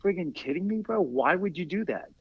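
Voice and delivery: high-pitched, incredulous scolding; mock outrage without raising the volume; retold indignation. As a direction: take a buddy to task, pitch jumping up. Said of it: "I got so mad".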